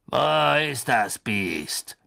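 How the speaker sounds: cold, slow, drawn-out demand; menacing executioner, slow and cold. Acted. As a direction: draw the question out slowly and coldly. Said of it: "sagte die kalte Stimme McNairs".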